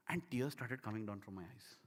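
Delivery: soft, low, moved voice; hushed, holding back real emotion; getting emotional. Real, not acted. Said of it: "I get that emotion".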